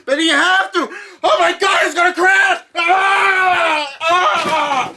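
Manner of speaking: high, loud frantic yells; panicked shouting. Retold or imitated. Narrator panicking as it's gonna crash. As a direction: scream like everything is crashing down.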